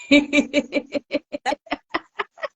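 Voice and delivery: high, long laugh; burst of laughter. Real, not acted. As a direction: laugh at her own joke. Laughs after joke.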